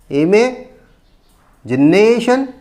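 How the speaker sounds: imagination broken slowly in two, with a long rising NA; stress on fourth syllable. Shown as a demonstration. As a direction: pause before the last part of imagination and punch NA. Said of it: "Stress on the fourth syllable". (imagination को तोड़कर, na पर ज़ोर)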